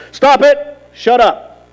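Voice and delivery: high, firm mock command; sharp rebuke. Jesus, just woken, rebuking the storm sternly. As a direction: order the storm to quit.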